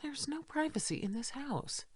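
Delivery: whispered, exasperated aside. Acted. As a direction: mutter it under your breath. Muttered aside after scolding.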